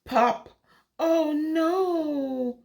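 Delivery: plain reading voice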